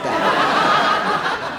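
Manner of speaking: high squealing laugh. Real, not acted. Comic laughing at her prison punchline.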